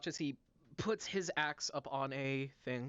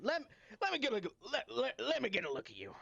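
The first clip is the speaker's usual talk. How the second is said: put-on high, fast eager old shopkeeper; excited old tiefling; 6 semitones higher, faster than usual. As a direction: rush the words, pitched up.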